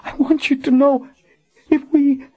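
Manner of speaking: high broken woman's voice trailing off; dying woman. Acted. Dying Colin tries to tell Richard, breaking off. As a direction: struggle to finish.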